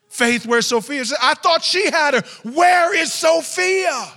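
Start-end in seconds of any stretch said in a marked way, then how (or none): 0.1-1.0 loud, high, urgent question; alarmed demand. Retold or imitated. Mother asking daughter where her sister is, alarmed. 1.2-4.1 high, full-voiced shout, slowing at the end; panicked mother. Mother shouting for missing Sophia, panicked.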